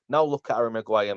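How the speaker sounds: ordinary talk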